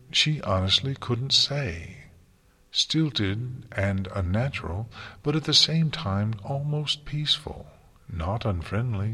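usual speaking voice

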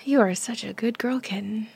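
normal talking voice

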